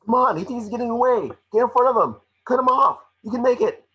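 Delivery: high, loud urging of a frantic coach; excited cheering. Acted. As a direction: shout him on through the chase. Pikachu cheering Tim in the chase.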